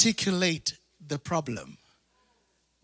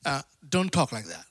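lower, softer pleading aside; hushed Peter; 5 semitones lower, 6 dB quieter, faster than usual. Retold or imitated. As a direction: pull Jesus aside quietly. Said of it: "Peter at one time says".

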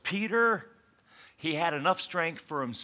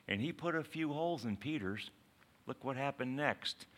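ordinary talk, nothing marked

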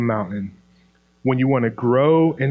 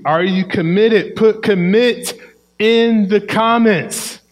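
emphatic call out; 6 semitones higher, 4 dB louder than usual. Real, not acted. Preacher calling on audience.

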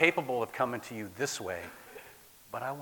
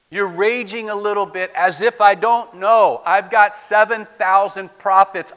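loud, higher, firm God voice; God's rebuke; 7 semitones higher, 14 dB louder than usual. Acted. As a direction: set Elijah straight.

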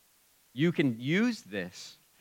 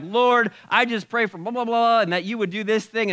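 imitating fervent prayer; 8 semitones higher, 7 dB louder than usual. Preacher imitates his own praying.

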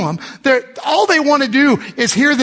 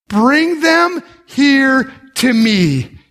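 very slow, word-by-word command; weighty command; 2 semitones higher, much slower than usual. Retold or imitated. Jesus answering his doubting disciples, slow and commanding. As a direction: give each word room as a deliberate order.

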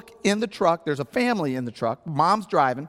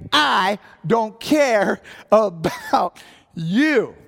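loud, high, very drawn-out yell; hammed-up re-enacted yell; 5 semitones higher, 5 dB louder, much slower than usual. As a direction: holler each word slowly at her. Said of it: "I'm yelling at a woman".

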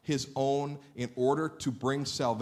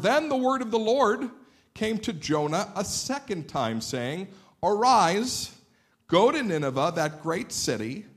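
declamatory Bible reading, higher and emphatic; scripture reading; 5 semitones higher, 5 dB louder than usual. Acted. Preacher reads Jonah chapter three to listeners, declamatory. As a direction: read the passage out with ringing emphasis.

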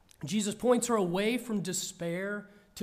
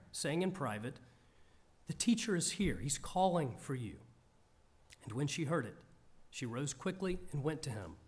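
calm, measured Bible reading, a touch lower; scripture reading; 3 semitones lower than usual. Acted. Reads the scripture passage aloud.

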